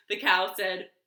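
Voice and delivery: ordinary delivery, nothing marked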